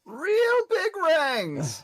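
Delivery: drawn-out high shout; yelling. Acted. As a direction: bellow the shop name slowly. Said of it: "as you're yelling".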